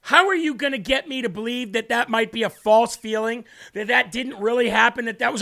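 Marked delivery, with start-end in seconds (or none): none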